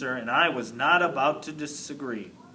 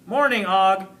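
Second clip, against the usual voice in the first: light, high squeak of a greeting; squeaky hamster greeting; 4 semitones higher, 6 dB louder than usual. A narrator's reading. Hamster squeaks a hello to his frog neighbor. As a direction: squeak it out brightly. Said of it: "I squeaked to him".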